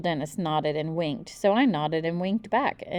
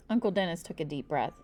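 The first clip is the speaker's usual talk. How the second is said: plain reading voice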